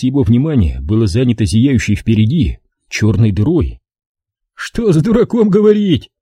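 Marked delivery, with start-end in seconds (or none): none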